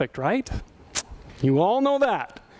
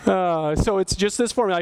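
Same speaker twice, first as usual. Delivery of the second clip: ordinary delivery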